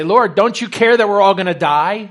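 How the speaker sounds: loud, panicked plea over the storm; exasperated cry. Retold or imitated. Disciples waking Jesus in the storm, loud panicked plea. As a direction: cry out as if the boat is sinking. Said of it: "where you're exasperated".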